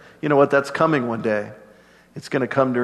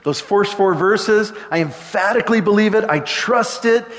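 higher, fervent declaration of trust; lifted, emphatic affirmation of faith; prayer of faith; 7 semitones higher than usual. Acted. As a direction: proclaim belief with growing conviction. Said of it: "a prayer of faith".

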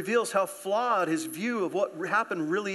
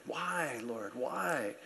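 hushed plea; 4 semitones lower, 8 dB quieter, much slower than usual. Acted. Voicing our cry to God.

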